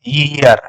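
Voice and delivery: "ईयर" as yi-yar, with an initial y; correct pronunciation. Shown as a demonstration. Teacher answering what the right pronunciation is, "ईयर" as yi-yar. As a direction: begin "ईयर" on a y glide. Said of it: "सही उच्चारण क्या है?".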